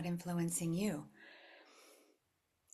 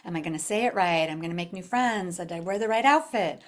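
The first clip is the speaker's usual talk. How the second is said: louder, anxious self-questioning; insecure inner voice; 8 dB louder than usual. Retold or imitated. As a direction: fret about fitting in. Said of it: "you're like".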